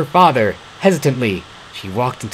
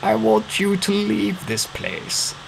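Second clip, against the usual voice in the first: put-on higher, softer, slow old man; old father voice; 10 semitones higher, 6 dB quieter, slower than usual. A narrator's reading. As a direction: plead like a weak father. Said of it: "said Ansem quickly".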